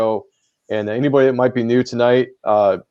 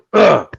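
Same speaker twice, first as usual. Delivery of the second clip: sharp cough to clear throat; 9 semitones higher, 7 dB louder than usual. Real, not acted. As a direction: clear your throat hard. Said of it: "choking on my own spit here".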